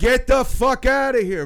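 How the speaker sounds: high, loud shout, laughing through it. Retold or imitated. Storyteller shouting the heckler down, high, loud and laughing. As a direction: blast him with a laughing yell. I'm like.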